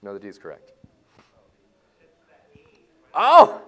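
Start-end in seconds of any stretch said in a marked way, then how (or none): 3.1-3.6 sudden high, loud oh; surprised teacher. Real, not acted. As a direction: catch the mistake out loud. Spots a mistake.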